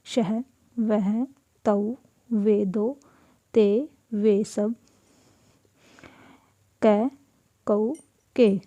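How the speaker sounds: slow and deliberate, word by word; slow classroom dictation pace; slow teaching read. Shown as a demonstration. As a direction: spell out each form slowly for students. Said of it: "जैसे".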